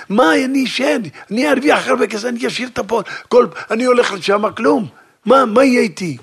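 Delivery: worried protest. Acted. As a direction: plead what will become of him.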